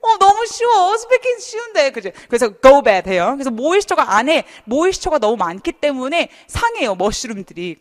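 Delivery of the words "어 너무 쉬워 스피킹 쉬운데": 1 mock squeal. Teacher teasing how easy.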